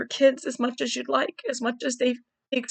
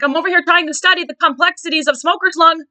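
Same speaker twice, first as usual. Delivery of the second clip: imitating mother; 7 semitones higher, 8 dB louder than usual. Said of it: "She's like".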